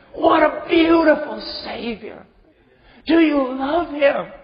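full-force sermon appeal; fiery preaching. Real, not acted. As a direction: press the listener with fervor.

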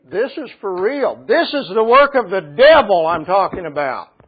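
preaching high and loud, slowing on the key words; full-force preaching. Acted. Pastor warning the church against the devil, preaching full force. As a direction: drive the warning home from the pulpit.

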